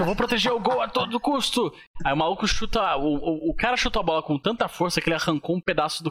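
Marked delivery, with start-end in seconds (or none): 0.0-1.7 earnest determined anime hero. Retold or imitated. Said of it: "O cara, tipo".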